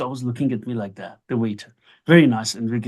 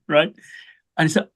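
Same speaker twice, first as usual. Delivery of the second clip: ordinary talk, nothing marked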